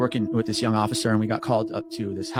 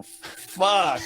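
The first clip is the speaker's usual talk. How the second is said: breathy gasped curse; 7 semitones higher, 3 dB louder than usual. Retold or imitated.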